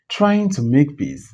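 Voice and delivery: ordinary delivery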